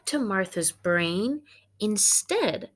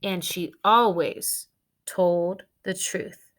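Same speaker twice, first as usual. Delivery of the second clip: ordinary delivery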